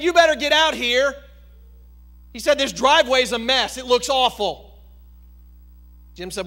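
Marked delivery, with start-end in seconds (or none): 0.0-4.8 loud, high furious demand; angry owner on the phone, louder and higher; livid homeowner. Acted. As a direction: bark it like an outraged homeowner. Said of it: "And boy, he was livid".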